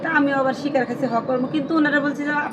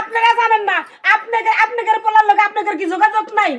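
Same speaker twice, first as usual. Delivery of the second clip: high, heated accusing voice; agitated high-pitched demand; angry accusation; 6 semitones higher than usual. Acted. Woman accuses family of hiding husband.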